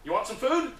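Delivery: high, loud imitated offer; chirpy offer. Volunteer offering food to screaming woman, chirpy.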